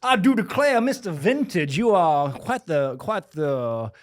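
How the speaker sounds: high, slow mock Southern planter; hammed-up Southern plantation owner; Southern accent. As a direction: ham up a genteel Southern drawl. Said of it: "master of running at a plantation".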